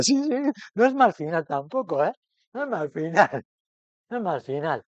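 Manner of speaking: loud, laughing concession. Real, not acted. Teacher admits to the class, laughing, it's not a bad ending. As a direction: concede it through real laughter.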